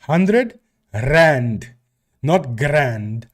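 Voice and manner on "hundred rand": "rand" with a more heavily rolled R; "Hundred rand", trilled R made clear. Shown as a demonstration. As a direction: roll the R hard at the start of "rand". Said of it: "roll my R's a bit more".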